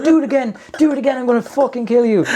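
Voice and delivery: high, rapid-fire retold rant; mock furious threat. Retelling the driver daring him, mock fury. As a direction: repeat the dare in worked-up mock rage.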